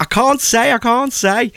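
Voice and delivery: high, sing-out mock secrecy; retold protest pitched right up; coy refusal. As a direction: playfully refuse to tell. Acts out coy refusal to tell.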